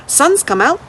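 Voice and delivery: put-on an old lady teasing a runaway boy, then inviting him in; lightly voiced old lady.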